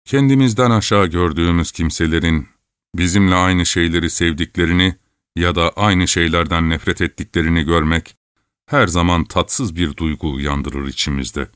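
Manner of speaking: plain reading voice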